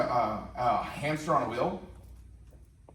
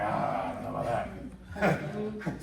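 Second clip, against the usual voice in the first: very slow, lower, doubtful whine; complainer; 5 semitones lower, 3 dB quieter, much slower than usual. Retold or imitated. The speaker mocks a chronic complainer turning down a chance, slow and low. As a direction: someone dragging their feet over a good offer.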